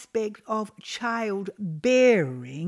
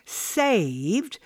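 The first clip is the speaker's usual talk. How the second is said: normal talking voice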